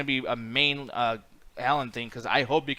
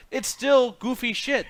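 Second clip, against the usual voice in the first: slow dismissive retort; 8 semitones higher, much slower than usual. Retold or imitated. Retorting to comic book fans.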